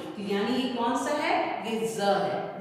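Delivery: ordinary delivery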